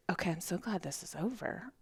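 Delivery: soft relieved voice; relieved sigh. Retold or imitated. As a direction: let it out quietly, relieved. Retold relief, very quiet.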